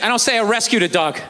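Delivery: ordinary talk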